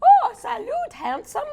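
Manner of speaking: high, giggling through the line; laughing. Acted. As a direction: crack up while voicing it. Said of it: "this one's saying".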